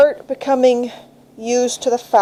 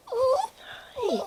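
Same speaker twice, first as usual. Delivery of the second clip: squeaky hi; 14 semitones higher, 14 dB quieter than usual. Real, not acted. Greeting puppy.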